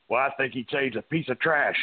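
lightly voiced tough wrestler sneering; a hint of a brash wrestler trashing someone; Stone Cold impression. Wrestler imitates his hero about a waiter, lightly sneering. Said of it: "I would just launch into the character".

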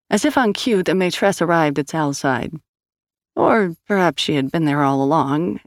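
plain reading voice

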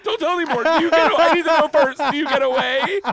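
put-on loud, very high kid voice; hammed-up urgent child, pleading loudly; imitated frantic daughter. Young daughter stopping dad's story, frantic and high. Retold daughter demanding the ending first.

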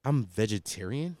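low, soft, slow deadpan; imitated appalled girlfriend. Vegetarian girlfriend answering her boyfriend, imitated low and slow disgust. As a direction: say it slowly, quietly aggrieved. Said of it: "She's like".

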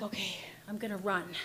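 low, near-whispered resolve; hushed inner thought. Retold or imitated. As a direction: think it to yourself under your breath. Secret thought of escape, told low.

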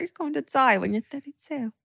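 mock British accent. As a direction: echo the seal's line in a British accent. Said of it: "in a British accent".

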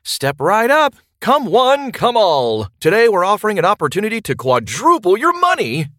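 loud, high carnival barker pitch; snake oil seller. Acted. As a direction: hawk it like a sideshow huckster. Carnival pitch opening the podcast.

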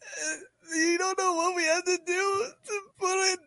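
high, hammed-up sobbing player voice; mock crying. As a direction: whine it like a blubbering athlete. Mock-crying football player in locker room.

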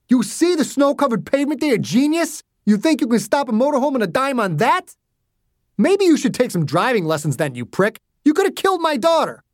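high-pitched, sarcastic angry shouting; heated argument. A narrator's reading. Driver and father arguing after near miss, irate. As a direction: trade insults in a roadside row. Two men in roadside shouting match.

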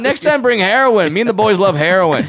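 cheerful dopey goon; goofy high voice. Pretend. Cartoon guard scene.

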